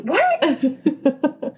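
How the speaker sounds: high, startled yelp through laughter; shocked. Retold or imitated. Husband hearing the babies come today, mock shocked.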